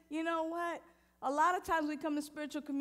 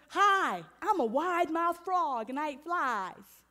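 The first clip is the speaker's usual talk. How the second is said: higher, louder chirpy frog greeting; lightly voiced frog, perky and loud; cheery frog; 3 semitones higher, 7 dB louder than usual. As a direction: bounce in like a proud little frog.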